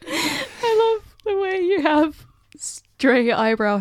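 high and giggling; barely holding back laughter; laughing through it. Shown as a demonstration. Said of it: "truth only".

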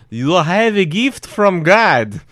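put-on slow, loud Greek accent; drawn-out earnest Greek accent. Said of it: "or however you do a Greek accent".

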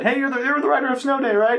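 acted-out hail with a checking question; dad's eager greeting, imitated. Dad recognizing the writer on Sunday, imitated eager greeting.

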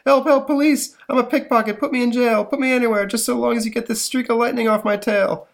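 high, loud squealing crook; shifty petty crook. Acted. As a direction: beg like a terrified thief. Pickpocket begging for jail.

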